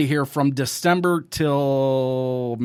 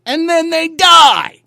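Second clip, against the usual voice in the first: loud, higher, slow shout; sudden shout; 12 semitones higher, 11 dB louder, slower than usual. Real, not acted. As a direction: slam the punchline of the rant, slow and loud. Punchline on deniers dying.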